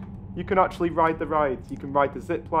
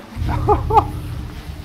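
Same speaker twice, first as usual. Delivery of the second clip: excited laugh; 4 dB louder than usual. Real, not acted. Excited gamer laughing at animation.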